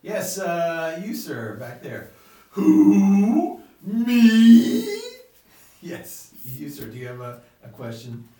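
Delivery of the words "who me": a sudden loud, higher "Who? Me?" from the crowd; loud audience member. Acted. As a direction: shout back as a surprised voter. Audience member called on by host.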